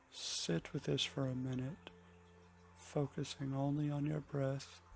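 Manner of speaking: soft, unhurried meditation voice with long pauses; hushed and calm, easing listeners into the breath; guided meditation. Acted.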